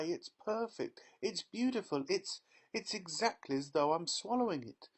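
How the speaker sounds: high, breathless rapture; excited. Acted. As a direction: gush like a greedy child. Girl raving over magic gum.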